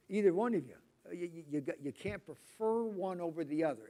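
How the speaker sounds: ordinary talk, nothing marked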